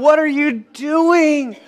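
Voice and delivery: ordinary delivery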